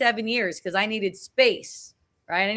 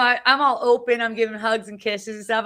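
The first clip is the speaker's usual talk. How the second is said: usual speaking voice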